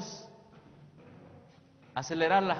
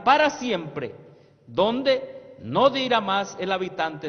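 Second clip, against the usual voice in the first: preaching; 5 semitones higher, 8 dB louder than usual. Acted. Preacher proclaiming a prophetic quote to the youth, loudly. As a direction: proclaim it to the whole church.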